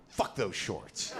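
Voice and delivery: slow, low, seething curse; angry inner voice. Retold or imitated. Comic's brain reacting to a stranger's shorts, angry. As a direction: spit it out slowly, as a retold angry thought. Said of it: "But that angry".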